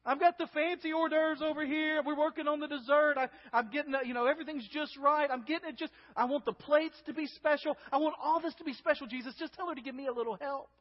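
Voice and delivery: high, harried, rambling grievance; urgent complaint. Acted. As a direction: fuss over every detail, then plead for help. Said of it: "You hear the urgency in her voice".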